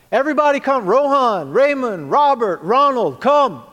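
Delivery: loud, high call across the park; father shouting for his sons to come; dad calling the kids. Retold or imitated. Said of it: "and he would say".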